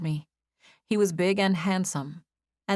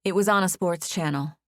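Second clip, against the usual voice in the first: plain reading voice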